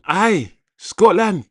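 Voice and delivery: slow, high put-on Scottish; rubbish Scottish accent. Host joking with a Scottish viewer, rubbish Scottish accent. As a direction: drag it out in a badly faked Scottish accent. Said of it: "That's a rubbish Scottish accent".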